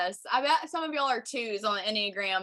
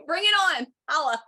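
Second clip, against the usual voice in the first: excited; 6 semitones higher, 6 dB louder than usual. Real, not acted. Hyping up live chat comments.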